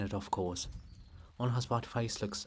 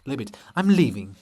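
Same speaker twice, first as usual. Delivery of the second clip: storming off; 3 semitones higher, 13 dB louder than usual. Acted. Olha storms off.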